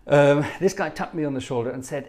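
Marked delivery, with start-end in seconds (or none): none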